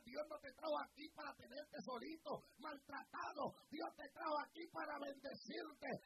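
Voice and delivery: loud, insistent preaching, hammering retén; full-force preaching. Acted.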